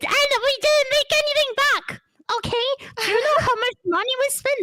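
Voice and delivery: high, fast indignant rant; ranting dictator speech. Acted. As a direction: rage about wasted money. Dictator rants about spending.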